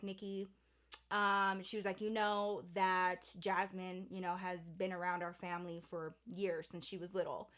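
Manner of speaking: hushed patient mother; calm mom explanation. Retold or imitated.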